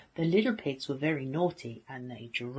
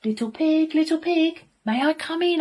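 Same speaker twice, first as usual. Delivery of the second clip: high, loud singsong wolf call; calling at the door; 12 semitones higher, 7 dB louder than usual. A narrator's reading. Friendly wolf at the little pig's house, calling out.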